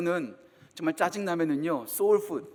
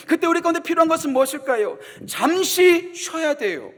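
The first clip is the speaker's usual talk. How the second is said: higher and louder, driving sermon; fervent preaching; 7 semitones higher, 7 dB louder than usual. Real, not acted. Pastor urging the tired congregation, full-force preaching. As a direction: preach it with rising urgency.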